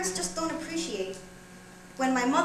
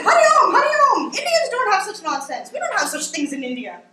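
outraged mother; 9 semitones higher, 10 dB louder than usual. Acted. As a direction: imitate an appalled, pious mother scolding. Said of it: "she's pissed".

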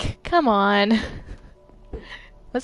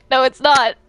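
ordinary talk

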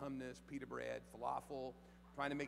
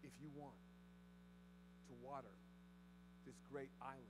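hushed appeal; 3 semitones lower, 16 dB quieter than usual. Acted. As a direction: draw them in close, almost confiding.

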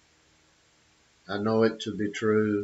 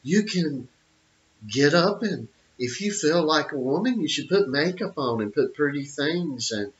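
higher, prissy mock lecture; mocking Neil deGrasse; 5 semitones higher than usual. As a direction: parody an expert preaching nonsense, pitched up. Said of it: "Neil deGrasse".

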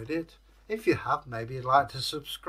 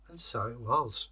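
ordinary delivery, nothing marked

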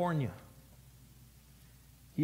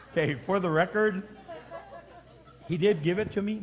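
laughing; 4 semitones higher than usual. Real, not acted. Pastor correcting the joke, laughing. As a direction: say it still laughing.

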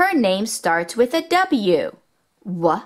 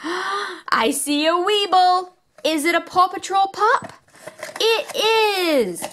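surprised; 9 semitones higher than usual. Real, not acted. Gasps, finding Zuma.

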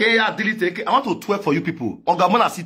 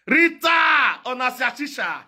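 calling out; 6 semitones higher than usual. Acted. Calling out to Rita.

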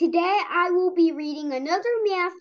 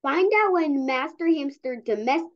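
plain reading voice

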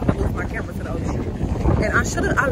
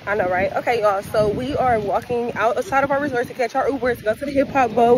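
ordinary talk, nothing marked

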